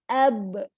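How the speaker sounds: "ab" with the b held and stopped dead; stopped letter demo. Shown as a demonstration. Teacher shows the class how "ab" stops short. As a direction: say "ab" and brake hard on the b. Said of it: "Ngerem dulu baru kol-kolahnya muncul".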